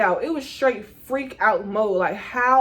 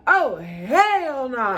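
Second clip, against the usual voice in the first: dismayed refusal; 4 semitones higher, 3 dB louder, much slower than usual. Retold or imitated. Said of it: "I'm like".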